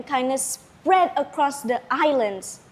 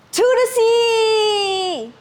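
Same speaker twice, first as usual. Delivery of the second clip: much higher, slow, drawn-out battle cry; rally with a long heroic shout; 10 semitones higher, 4 dB louder, slower than usual. Acted. Lagaligo charges into the storm with a long shout. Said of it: "he shouted".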